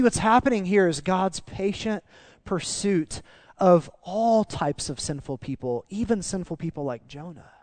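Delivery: ordinary talk, nothing marked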